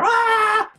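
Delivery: very high, loud imitated scream; mock scream. Imitating what sister would answer back, mock shriek. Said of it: "that would just be more".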